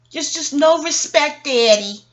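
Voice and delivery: teasing rebuke to a partner pretending to sleep; mock scolding. Real, not acted.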